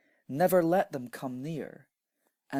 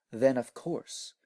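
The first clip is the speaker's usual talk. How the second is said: ordinary delivery, nothing marked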